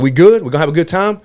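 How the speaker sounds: loud, high challenging question; challenging check-in. Retold or imitated. Retold confrontation with hecklers.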